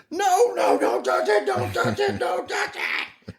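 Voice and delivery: very high, drawn-out banshee wail; banshee scream. Retold or imitated. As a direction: mimic a terrified child screaming like a banshee. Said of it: "He goes to screaming like a damn somebody going, I mean, like a banshee".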